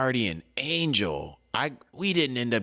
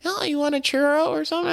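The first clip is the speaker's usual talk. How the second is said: much higher, quick and lilting mock wife voice; imitating the wife; 3 dB louder, faster than usual. Husband mimics his wife offering a churro, high mock voice. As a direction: play the wife offering a treat, high and light.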